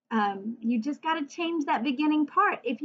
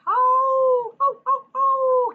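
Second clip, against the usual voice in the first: high loud howling like a wolf; long loud wolf howl; 11 semitones higher, 6 dB louder, slower than usual. Shown as a demonstration. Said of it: "Can you howl like a wolf?".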